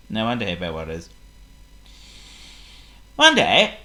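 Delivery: plain reading voice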